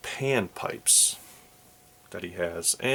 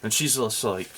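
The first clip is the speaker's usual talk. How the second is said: ordinary delivery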